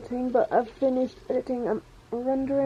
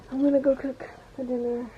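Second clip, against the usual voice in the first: normal talking voice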